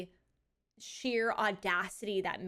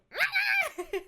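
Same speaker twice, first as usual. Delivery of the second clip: shrieking laugh; 7 dB louder than usual. Real, not acted. Chased by a dog.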